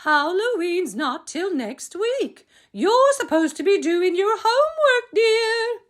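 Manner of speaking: very high singsong mom voice; high-pitched motherly reminder; scolding mother. A narrator's reading. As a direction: chide sweetly in a high lilt. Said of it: "called his mother".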